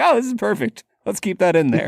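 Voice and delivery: amused, lifted mock approval; imitated delight. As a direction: imitate a pleased film crew. Voices the delighted film makers.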